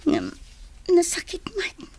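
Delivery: tearful whisper. Acted. Jenny's lament, breaking down.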